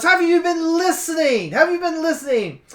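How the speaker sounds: loud, high, exasperated demand; exasperated outburst. Acted. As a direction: burst out at someone who never listens. Exasperated reply to repeated why.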